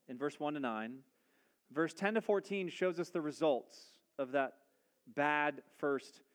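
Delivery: usual speaking voice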